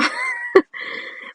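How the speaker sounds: short squeal of a laugh; high squeaky laugh. Real, not acted. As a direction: laugh at your younger self. Laughing at childhood self.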